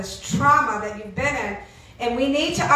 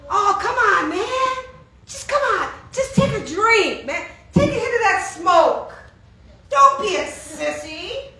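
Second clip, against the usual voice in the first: peer-pressuring friend; 7 semitones higher than usual. Acted. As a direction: needle them into joining in. Acts out friends pressing to drink, smoke.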